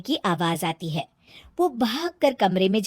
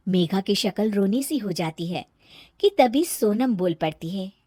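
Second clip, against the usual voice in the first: ordinary delivery, nothing marked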